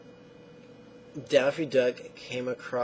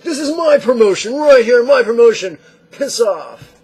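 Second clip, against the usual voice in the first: much higher, louder greedy yell; guard your promotion like a mock snarl; possessive shout; 10 semitones higher, 14 dB louder than usual. Acting possessive worker.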